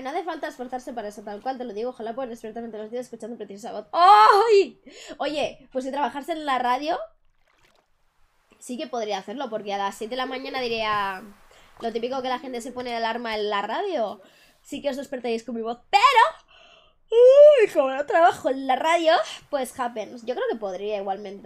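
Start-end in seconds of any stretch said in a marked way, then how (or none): 3.9-4.8 sudden high yelp; startled yelp. Real, not acted. Startled cry in game. 17.1-17.7 sharp high uy; surprised uy. Real, not acted. Startled in game.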